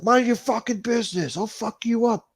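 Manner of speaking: very high, loud mock threat; hostile Cuomo. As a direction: snarl back a hammed-up threat. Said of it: "Chris Cuomo was like".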